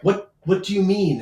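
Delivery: high, sharp shocked question; startled question. Acted. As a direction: caught off guard, demand it. Lord startled by accusation.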